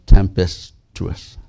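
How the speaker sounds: Tempestuous spelled out slowly, each syllable apart; slow Tempestuous right down, syllable by syllable. Shown as a demonstration. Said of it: "try to say it slow".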